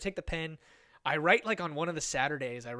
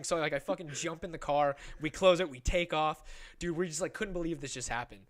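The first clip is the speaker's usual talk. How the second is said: ordinary talk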